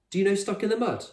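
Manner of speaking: high, hopeful question; eager Fred. A narrator's reading. Fred proposing a game to Con, eager. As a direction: offer the game brightly. Fred voiced in read story.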